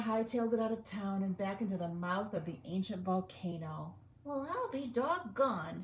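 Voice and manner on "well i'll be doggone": higher, slow mouse voice; squeaked. Acted. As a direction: marvel like a tiny mouse. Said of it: "squeaked the Big Cheese".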